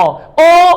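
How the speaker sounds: ओ called out high and loud, lips rounded; loud ओ demo. Shown as a demonstration. Teacher demonstrates ओ for students, loud. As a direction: shout ओ from the throat with round lips. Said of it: "कंठोष्ठ में ओ और औ".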